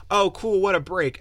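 high, loud mock cheer; sarcastic. [name] sarcastic about his luck, loud mock cheer. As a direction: toss it off with bitter sarcasm. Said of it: "And it's like".

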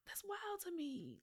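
hushed amazed whisper. Real, not acted. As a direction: whisper your disbelief. Astonished whisper.

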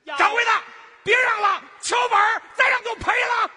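very high, very loud hawker's holler; top-pitch shout. Shown as a demonstration. Eager helper jumps in too early, top-pitch shout. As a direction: yell at the top of your range. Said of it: "我这个高不了那么多了".

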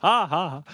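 canned sitcom laughter ha ha ha; mock laugh track. Joking about adding a laugh track, fake canned laughter. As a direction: laugh like the studio audience track. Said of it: "the laugh track that we'll put in afterward".

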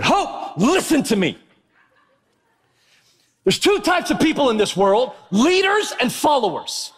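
high-pitched, exasperated retold scolding; angry dad lecture. Dad retelling his lecture to baby Hope, mock angry. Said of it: "I get really angry".

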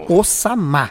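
Osama stressed on the last A, Osamá; correct name pronunciation. Shown as a demonstration. Guest shows the host how his name is said, Osamá with final stress. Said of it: "a pronúncia correta é o que o Hélio falou".

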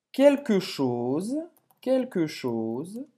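quelque chose said slowly, each syllable clear; slow model phrase. Shown as a demonstration. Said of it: "Repete aí".

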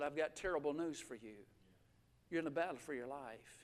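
low, very soft bad-news voice; grave, hushed. Doctor giving a patient terrible news, hushed and grave.

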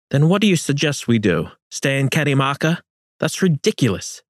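high sneering comeback; scornful retort. Acted. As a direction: sneer back at him. Francisco retorting.

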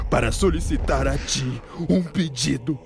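lightly voiced begging hero; humble pleading. Troy pleading to the monster.

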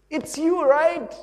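high, loud squeal of recognition; excited recognition. Acted. Stranger greeting someone in heaven, excited high shout. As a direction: pitch it way up, thrilled to spot someone.

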